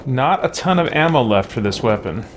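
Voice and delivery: ordinary delivery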